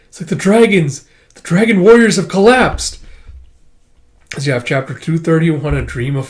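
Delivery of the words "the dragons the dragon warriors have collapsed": high, loud, urgent news; acted panicked messenger; alarmed report. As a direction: cry out the emergency.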